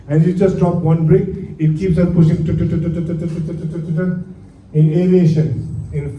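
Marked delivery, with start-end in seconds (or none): 2.4-4.2 rapid chain-reaction noise. Retold or imitated. Instructor showing students bricks knocking each other down, rattled tu-tu-tu noise. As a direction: imitate bricks toppling one after another. Imitating bricks falling one after another.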